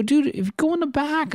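high-pitched pleading reprimand; retold exasperated telling-off. Comic telling an audience member off, exasperated.

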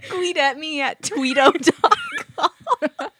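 laughing through the words; giggling, can barely get it out. Real, not acted. Giggling over the silly joke.

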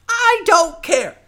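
very high, loud and slow goblin retort; young goblin refusing to stay behind; defiant young goblin. Acted. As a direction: dig in like a stubborn goblin kid. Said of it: "this young goblin".

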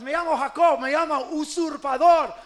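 high, very loud dramatic outcry; shouted confession. Acted. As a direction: cry out the names like a desperate confession. Acts out Jacob's shouted confession. (confesión a gritos, dramatizada)

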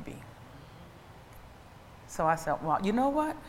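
low, soft and measured; hushed, low-pitched counsel; quiet resigned reply. Retold or imitated. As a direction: drop right down, pausing between phrases. Gentle reply to someone leaving church.

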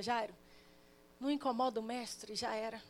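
soft and low, breaking it gently; hushed bad news. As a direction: murmur the grim news aside. Servant brings news of death.

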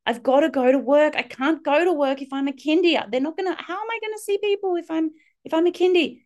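high, whiny mock protest; mock pleading parent. Mum turned kindy begging her daughter, high mock protest. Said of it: "squatting like a duck walking along going".

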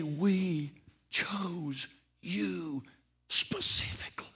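slow soft whisper. Acted. Father to adopted son, slow tender whisper. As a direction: tenderly breathe the words.

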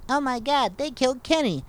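high-pitched cartoon kid cry; high, mock-shocked cartoon voice; South Park catchphrase. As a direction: squeal it like a South Park character.

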